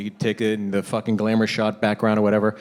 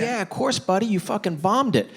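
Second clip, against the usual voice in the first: high, indulgent praise; falsely encouraging dad; 8 semitones higher than usual. Acted. Dad reassures son after a bad throw, high encouraging voice. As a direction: humour the kid with lifted pitch.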